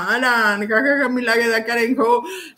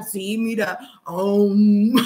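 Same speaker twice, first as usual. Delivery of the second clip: forced seriousness; 6 semitones lower, much slower than usual. Real, not acted. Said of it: "Yo quiero ser seria".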